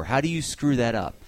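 ordinary talk, nothing marked

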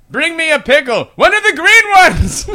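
hammed-up high, insistent voice; demanding old man. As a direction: demand it like a cranky old guy. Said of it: "he goes to the waitress".

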